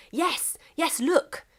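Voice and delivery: high eager discovery; a high, eager "yes, yes"; excitedly. Acted. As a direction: point it out excitedly. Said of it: "England pointed excitedly".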